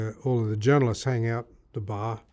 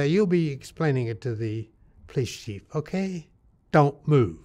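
put-on British authority, ending firm; mock British officer; very British accent; 3 semitones higher than usual. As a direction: give the order in an exaggerated British accent. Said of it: "And in a very British accent".

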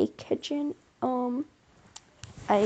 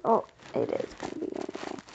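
quick whispered aside; 9 semitones lower, 8 dB quieter, faster than usual. Real, not acted.

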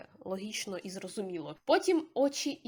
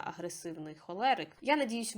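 ordinary talk

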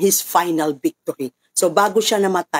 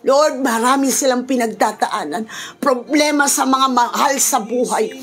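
prayer; 9 semitones higher than usual. Acted. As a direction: pray aloud for the whole congregation, rising in fervour. Pastor continues prayer over music. (taimtim na panalangin na lumalakas at tumataas)

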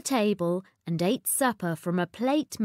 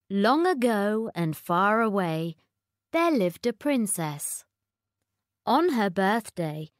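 usual speaking voice

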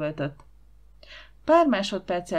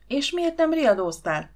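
higher-pitched indignant question; raging; 7 semitones higher, 4 dB louder than usual. A narrator's reading. Aunt scolding the boy for not raising alarm, raging. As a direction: demand it crossly, pitch jumping up. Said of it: "dühöngött a néni".

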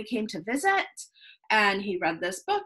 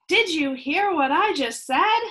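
higher, louder nagging parent scold; exasperated parent; 7 semitones higher, 4 dB louder than usual. Acted. Parent scolding a child who ignores the rules, exasperated. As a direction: snap it out like a fed-up mom.

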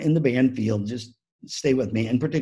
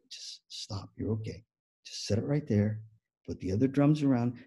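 calm reassuring; 6 dB quieter than usual. Retold or imitated. Helper settling a nervous band dad, calm and soft. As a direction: talk a panicker down softly.

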